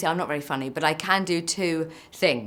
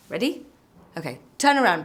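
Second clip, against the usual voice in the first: ordinary delivery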